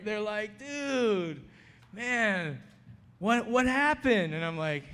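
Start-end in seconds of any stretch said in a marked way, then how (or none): 0.6-4.3 curious inmates. Retold or imitated. Inmates asking what happened.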